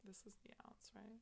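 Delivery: a soft whisper while measuring. Real, not acted. Measuring the liqueur.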